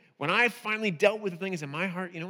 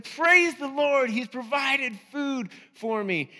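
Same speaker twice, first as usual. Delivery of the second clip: joyful praise; 5 semitones higher, 4 dB louder, slower than usual. Retold or imitated. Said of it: "she said".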